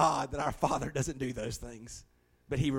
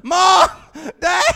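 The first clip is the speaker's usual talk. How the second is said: put-on child calling out; 16 dB louder than usual. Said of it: "We heard her".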